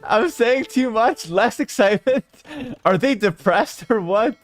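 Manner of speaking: helpless high-pitched giggly protest; slow, laughing disbelief; real laughter breaking into the words. Real, not acted. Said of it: "Now I'm just laughing".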